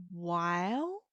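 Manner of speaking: drawn-out "Well" dipping low, then rising; hesitant. Shown as a demonstration. Teacher imitates English hesitation, long dipping "Well". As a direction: stretch "Well" doubtfully, swooping back up. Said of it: "when we hesitate".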